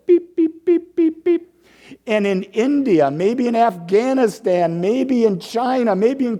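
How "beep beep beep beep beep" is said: high, loud mock beeps; beacon beeping. Minister playing a beacon calling to a partner, high mock beeps. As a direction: play a signal beacon calling out. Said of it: "You're a beacon over there going".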